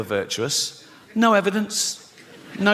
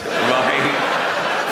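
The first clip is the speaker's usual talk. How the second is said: usual speaking voice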